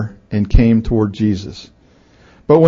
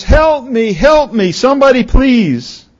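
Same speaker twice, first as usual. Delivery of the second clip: high-pitched cry for help; acted scream; 11 semitones higher, 3 dB louder than usual. Boy dangling from a cliff, screaming for help. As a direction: call out desperately, pitch right up. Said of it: "screamed out in a loud voice".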